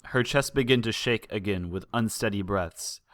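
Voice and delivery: ordinary delivery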